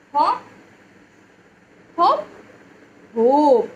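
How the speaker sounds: "hop" short and high, "hope" with a long o. Shown as a demonstration. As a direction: contrast "hop" and "hope" clearly. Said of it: "hop and hope contrast".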